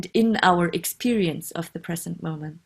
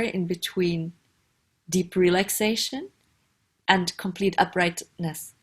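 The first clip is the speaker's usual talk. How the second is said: guided meditation. Acted. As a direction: ease the listener into a comfortable posture, unhurried.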